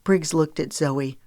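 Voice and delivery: usual speaking voice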